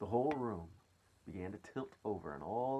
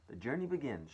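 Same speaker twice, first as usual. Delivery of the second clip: raised-pitch, exultant announcement; shouted proclamation; 6 semitones higher, 4 dB louder than usual. A narrator's reading. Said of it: "shouted the centipede".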